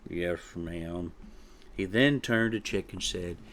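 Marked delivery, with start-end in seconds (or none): 0.1-1.1 very soft, low-energy surrender; mumbled. A narrator's reading. Chick gives up arguing with mother, mumbling. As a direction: mumble it, defeated and quiet. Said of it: "and mumbled".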